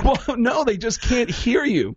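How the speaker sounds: high, slowed, emphatic explanation; exasperated explaining. Retold or imitated. Comic explaining deafness to comedian, high slow exasperation.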